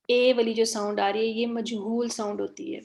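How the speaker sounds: ordinary talk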